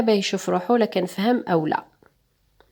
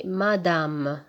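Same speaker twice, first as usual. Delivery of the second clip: slowly spelled word; 4 semitones lower, 6 dB quieter than usual. Shown as a demonstration. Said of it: "le deuxième mot".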